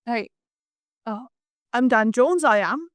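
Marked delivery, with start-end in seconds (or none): 1.7-2.9 light mock Welsh accent, rising at the end; a playful imitation of a Welsh accent. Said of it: "Do it".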